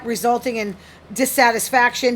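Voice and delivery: ordinary talk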